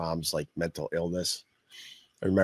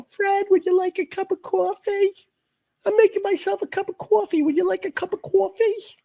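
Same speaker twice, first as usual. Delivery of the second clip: high, loud Long Island mom voice; mom's Long Island accent; 5 dB louder than usual. Retold or imitated. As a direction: call out shrilly in a hammed-up Long Island accent. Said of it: "with the Long Island accent".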